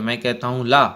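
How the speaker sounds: villain shouting at a hesitant servant. A narrator's reading. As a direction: yell the order at the servant. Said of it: "चीख करके वे कहते हैं".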